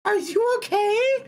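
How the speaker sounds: very high, squeaky mock-concerned voice; squeaky put-on voice. Streamer to chat in a squeaky put-on voice. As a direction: pitch it way up like a tiny cartoon critter. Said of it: "from me speaking like that".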